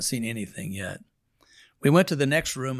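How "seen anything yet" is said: a hushed promise whispered to the sister. Retold or imitated. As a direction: drop to a whisper, full of anticipation. Confiding to the sister.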